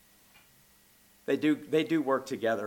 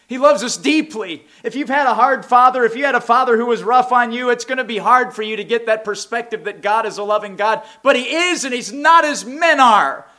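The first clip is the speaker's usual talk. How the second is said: higher and louder, fervent sermon; full-force preaching; 10 semitones higher, 12 dB louder than usual. Acted. Preacher urging the congregation, fervent and loud.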